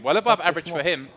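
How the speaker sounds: normal talking voice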